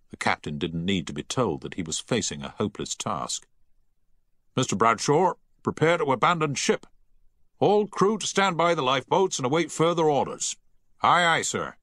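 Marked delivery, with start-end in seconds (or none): 4.6-10.6 higher, raised captain giving the order; firm naval command. A narrator's reading. Captain orders the crew to the lifeboats, commanding. 11.0-11.8 a mild shout, higher; shouted reply. A narrator's reading. Officer answers the captain with a shout. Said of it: "shouted Bradshaw from the deck".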